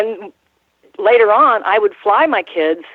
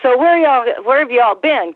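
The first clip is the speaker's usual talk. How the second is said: officer's question; 6 semitones higher than usual. Retold or imitated. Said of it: "He was like".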